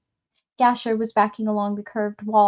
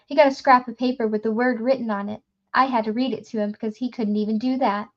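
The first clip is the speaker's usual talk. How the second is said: fast nervous pleading; faster than usual. Acted.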